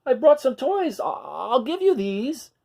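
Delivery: high dinosaur voice, begging; pleading little dinosaur. Acted. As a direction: coax them to take the toys, trailing off. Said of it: "tried saying".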